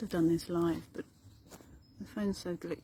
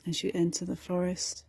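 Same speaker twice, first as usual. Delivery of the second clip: guided meditation; 7 dB louder than usual. Acted. Meditation teacher guiding listeners through meadow and forest, guided meditation. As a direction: lead them unhurriedly through the imagery.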